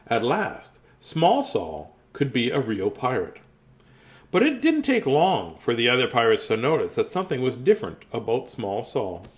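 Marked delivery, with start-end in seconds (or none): none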